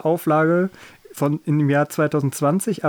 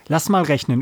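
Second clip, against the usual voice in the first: usual speaking voice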